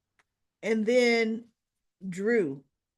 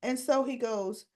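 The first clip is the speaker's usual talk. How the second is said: usual speaking voice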